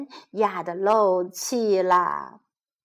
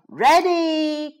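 high, loud starter's call; storybook character calling out; crying out; 5 semitones higher, 5 dB louder than usual. A narrator's reading. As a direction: call it out to the racers, raised and drawn out. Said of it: "cried Roger".

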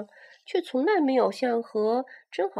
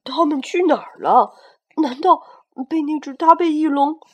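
worried; 8 semitones higher, 14 dB louder than usual. Acted. T-rex searching, worried.

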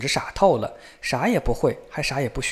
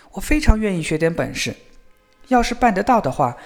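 put-on higher, earnest boyish voice; eager young son; 6 semitones higher, 3 dB louder than usual. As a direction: answer father with keen willingness. Younger son eagerly answers father.

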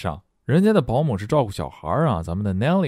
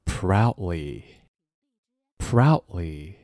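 read-aloud drill; 3 semitones lower, much slower than usual. Shown as a demonstration. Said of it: "再跟着[name]我们大声朗读".

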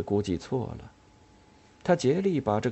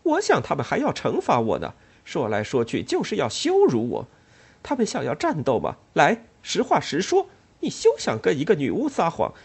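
higher, louder defiant tirade; defiant anger; 5 semitones higher, 4 dB louder than usual. Acted. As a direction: rise up in defiance. Ellen defiant, growing louder.

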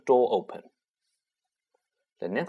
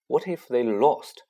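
ordinary delivery, nothing marked

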